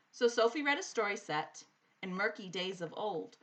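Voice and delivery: usual speaking voice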